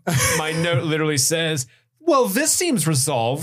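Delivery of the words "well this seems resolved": slow, higher-pitched sarcasm; sarcastic. Acted. Host reads their own note aloud, sarcastic. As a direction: draw it out with mock satisfaction.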